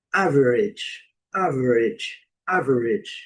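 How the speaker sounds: "Average" with first syllable strong, ending "idge"; correct pronunciation. Shown as a demonstration. Teacher modelling the word for learners, "Average" ending in short "idge". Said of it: "a pronúncia correta".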